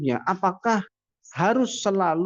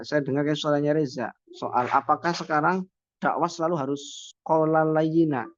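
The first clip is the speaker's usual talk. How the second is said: usual speaking voice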